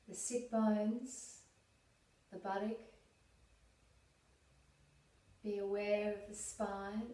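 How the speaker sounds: calm, unhurried meditation voice with long pauses; guided meditation. Real, not acted. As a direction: guide them gently inward, leaving space to breathe.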